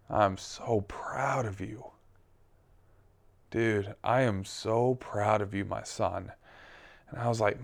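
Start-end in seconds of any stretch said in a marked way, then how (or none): 0.1-1.9 soft, slow, tender repeat; gentle voice of God. Retold or imitated. 3.5-6.3 softer, loving father's praise; tender voice of God. Retold or imitated.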